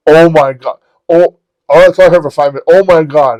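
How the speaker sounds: loud, high acted outburst; outraged. Woman learns who is on the phone, mock outraged. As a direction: belt it out like a horrified woman, retold.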